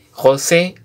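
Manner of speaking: ordinary talk, nothing marked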